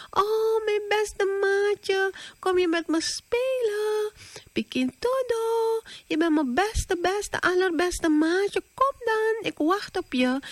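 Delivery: high, honeyed coaxing voice; butter up your best friend, high and sweet; sweetest voice. Acted. Pikkie luring Todo to play, in his sweetest high voice. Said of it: "zo lief, met zijn liefste stem".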